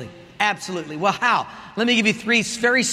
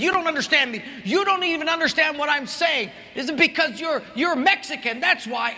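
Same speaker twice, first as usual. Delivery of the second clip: mock angry shout; 6 semitones higher than usual. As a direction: play the rude passenger ranting. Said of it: "She said".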